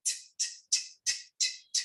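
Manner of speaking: a quiet unvoiced "t", ticked out like a tennis ball; soft repeated t. Shown as a demonstration. Teacher showing the t sound with the tennis action. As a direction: tick "t" back and forth, softly. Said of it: "So the sound is t".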